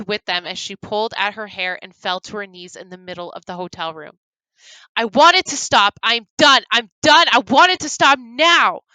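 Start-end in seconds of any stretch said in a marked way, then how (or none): 4.9-8.8 loud, higher desperate yell. Acted. Grum yelling at the unseen captors, desperate. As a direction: scream that you are done. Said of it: "Grum yelled".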